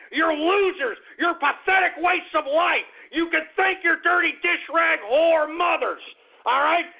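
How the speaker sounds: scornful, worked-up harangue; angry tirade. Real, not acted. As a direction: berate them at a high pitch. Hurling insults at his listeners.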